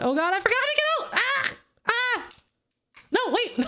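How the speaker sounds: high frantic screaming, very fast; frightened cries, fast and high; panicked shrieks. Real, not acted. Panicked escape in game.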